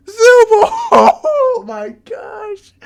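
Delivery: high, slow, laughing squeal; squealing delight. Real, not acted. Player squealing as Zubul storms in, high and laughing. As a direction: gush over the twist.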